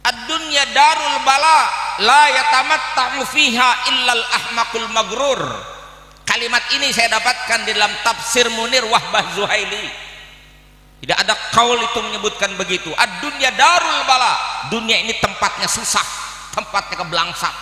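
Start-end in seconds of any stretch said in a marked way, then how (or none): 0.0-5.4 loud, high ringing recitation; high-pitched preacher's proclamation; forceful Arabic declamation. Real, not acted. 13.0-14.3 loud, high proclamation; high, forceful preacher's citation; emphatic Arabic declamation. Real, not acted. Repeats the Arabic quotation emphatically.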